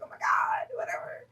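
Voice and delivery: breathless whisper of alarm; whispered panic. Retold or imitated. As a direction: whisper it like a hushed shriek.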